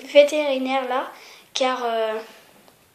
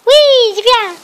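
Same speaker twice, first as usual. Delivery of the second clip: high, loud praise for a dog; 8 semitones higher, 11 dB louder, slower than usual. Real, not acted.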